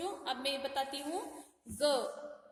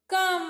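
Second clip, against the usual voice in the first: कम said higher and louder; loud high syllable; 4 semitones higher, 9 dB louder than usual. Shown as a demonstration. Teacher sounding out कम for children, high and loud. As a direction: pitch कम up and loud. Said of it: "देखो बच्चों".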